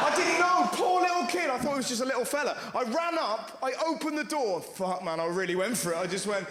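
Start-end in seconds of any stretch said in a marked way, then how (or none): 0.1-1.5 much higher, loud cry of dismay; loud guilty exclaim. Real, not acted. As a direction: loudly protest your innocence.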